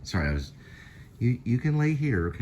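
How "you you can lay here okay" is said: talking to the cat. Real, not acted.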